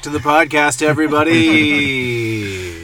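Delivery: slow, loud announcer welcome; podcast host intro. Acted. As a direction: open the show like a host. Show intro after break.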